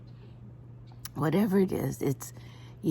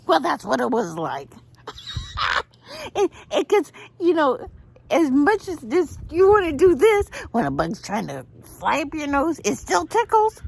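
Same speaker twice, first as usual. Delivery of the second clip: laughing while talking; 11 semitones higher than usual. Real, not acted. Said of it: "I couldn't".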